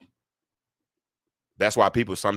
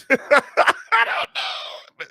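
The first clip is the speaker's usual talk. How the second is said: laughing; 4 dB louder, much slower than usual. Real, not acted. Laughing after the clip.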